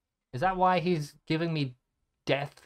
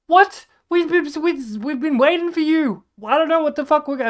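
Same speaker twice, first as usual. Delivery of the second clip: high, loud, incredulous shouting; exasperated outburst; 14 semitones higher, 11 dB louder than usual. Retold or imitated. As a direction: yell it in disbelief at being left waiting. Acting out outraged reaction to friend leaving.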